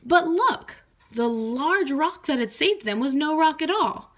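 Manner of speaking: storyteller's big reveal; surprise. A narrator's reading. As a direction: gasp at the discovery.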